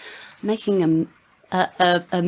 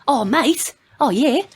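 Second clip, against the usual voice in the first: put-on Australian accent; 5 semitones higher, slower than usual. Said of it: "everyone's best Australian accent".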